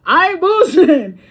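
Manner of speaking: laughing insistence. Real, not acted. Insisting after a wild story.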